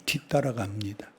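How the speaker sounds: unvoiced hush; whisper. Preacher narrates Peter following from afar, whispering. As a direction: tell it like sneaking behind.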